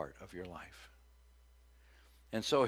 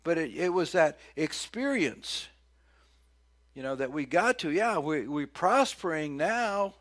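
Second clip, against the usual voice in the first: preaching; 5 semitones higher, 7 dB louder than usual. Acted. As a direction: lean into prospering now. Preacher rising again.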